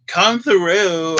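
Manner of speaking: high, loud, drawn-out cheer; hyped exclamation. Real, not acted. Reader hyping up the cards that came through, hyped exclamation. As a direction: belt it out in approval.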